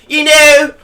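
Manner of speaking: high, loud mock-sweet voice, slowing at the end; hammed-up Io vowing devotion; Io impression. Mocking Io's promo to her partner, high and loud. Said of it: "that's my best E O impression".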